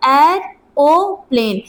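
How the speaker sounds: Aeroplane drawn out slowly in separate syllables. Shown as a demonstration. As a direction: break aeroplane into slow parts, ए-रो-प्लेन. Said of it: "मैंने यहाँ पे क्या पढ़ा था".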